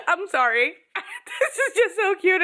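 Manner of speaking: talking through giggles; laughing. Real, not acted. Still giggling over the cute scene. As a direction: giggle while you apologize.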